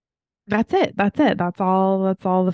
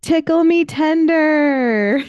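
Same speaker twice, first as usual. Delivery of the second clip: high, drawn-out yell, last word held long; retold child's yell; 10 semitones higher, much slower than usual. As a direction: holler it like a kid imitating a pirate, stretched out.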